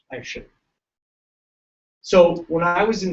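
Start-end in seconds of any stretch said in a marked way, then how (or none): 0.1-0.5 hushed confiding whisper. Real, not acted. Speaker confides to chiropractic students in a whisper. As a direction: lean in and whisper it.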